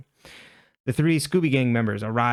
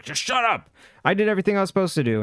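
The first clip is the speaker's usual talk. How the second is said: higher, fast, half-acted angry yell; retold yelling; 6 semitones higher, faster than usual. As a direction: snap it back quickly, mock-furious. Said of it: "He yells at her".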